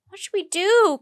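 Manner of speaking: high loud frightened cry. A narrator's reading. Annie crying out to Jack while being chased. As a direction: call out in panic. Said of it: "cried Annie".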